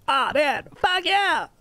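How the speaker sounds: put-on high, whiny bratty teen voice; mocking teenage skater. Mocking how teens at the skate park talk, high and bratty. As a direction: sneer it like a snotty skate park kid. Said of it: "when I hear that voice from a teenager".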